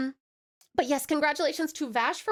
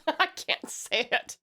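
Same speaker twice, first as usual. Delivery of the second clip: ordinary talk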